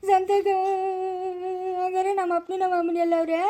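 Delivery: whining, tearful mock bawl; hammed-up crying. Son told he was bought at a market, hammed-up crying. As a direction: wail the words in long drawn sobs. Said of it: "ಅಳ್ಬೇಡ, ಸುಮ್ನೆ ಇರ".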